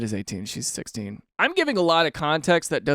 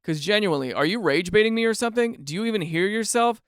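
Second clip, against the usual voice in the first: ordinary talk, nothing marked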